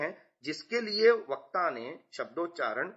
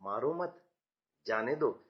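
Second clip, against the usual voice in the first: softer मारो मत, pause, lower जाने दो; pause after मत; 4 semitones lower, 4 dB quieter than usual. Shown as a demonstration. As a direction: join मारो to मत, then let जाने दो drop. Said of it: "लेकिन दूसरे वाक्य में".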